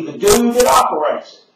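shouting at the top of the rant. Real, not acted. Ranting about his channel.

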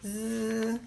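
low, soft zzz; sleeping buzz. A narrator's reading. Worn-out dragon can finally rest, low soft zzz. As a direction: hum a quiet sleepy buzz. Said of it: "por fin puede descansar".

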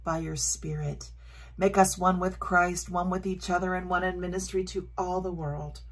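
soft, reverent liturgical prayer. Acted. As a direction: pray the words gently and solemnly. Prays the communion liturgy.

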